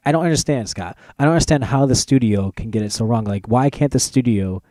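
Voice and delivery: usual speaking voice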